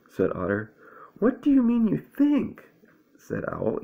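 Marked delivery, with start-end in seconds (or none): 1.2-2.6 high, loud exasperated question; irritated Owl. A narrator's reading. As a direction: challenge Otter in a raised, high voice. Owl challenges Otter, irritated.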